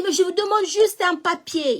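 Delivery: high, loud frustrated shout; shouting at the court clerk for a paper. Retold or imitated. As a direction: yell it at the clerk. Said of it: "je commençais à crier".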